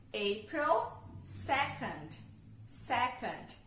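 slow "April second", the "second" repeated; English date model. Shown as a demonstration. Teacher repeats the date for learners, slow and careful. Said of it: "Dois de abril".

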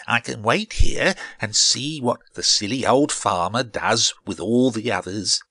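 lightly voiced cheeky seed, a little loud; lightly put-on smug character voice. A narrator's reading. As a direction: talk to yourself as a pleased-with-himself seed.

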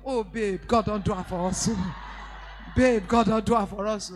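loud, laughing burst of joy; overjoyed wife. Retold or imitated. Wife hearing husband's promotion, loud joyful laughing outburst. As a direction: gush with delight at the husband.

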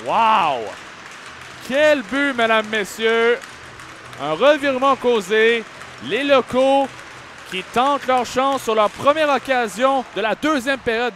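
high, amazed play-by-play; excited sports commentary. Real, not acted. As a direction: marvel at the goal. Caller reacting to goal. (commentaire excité, voix haute)

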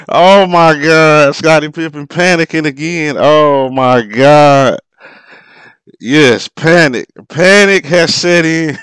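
loud, high, slowed cries of disbelief; exasperated shouting. Real, not acted. Fan groaning at Pippen panicking, loud drawn-out exasperation. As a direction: drag it out, can't believe the panic.

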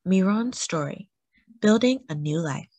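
plain reading voice

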